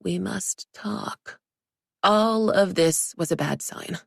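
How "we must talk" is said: slow hushed whisper. A narrator's reading. Narrator whispers the ominous email line. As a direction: breathe it like a grim warning.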